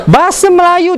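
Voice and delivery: ordinary talk, nothing marked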